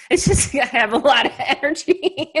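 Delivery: giggly, amused admission; laughing. Real, not acted. Laughing about ADHD.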